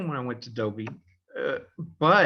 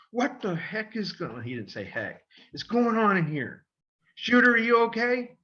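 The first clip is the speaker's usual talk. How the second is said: coach yelling; 7 semitones higher than usual. Retold or imitated. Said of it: "one of the coaches comes in and goes".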